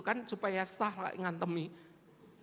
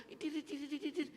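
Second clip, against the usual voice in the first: soft, higher idir idir for a thin trickle; trickling water; 4 semitones higher, 8 dB quieter, faster than usual. Retold or imitated. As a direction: mimic the last drips of water. (menirukan air menetes pelan)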